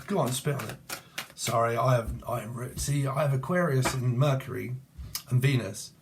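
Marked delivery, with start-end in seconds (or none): none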